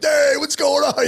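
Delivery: high, slow, friendly call of hey; cheery greeting. Retold or imitated. Rookie greeting everybody, high cheery call. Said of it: "I was kinda coming in like".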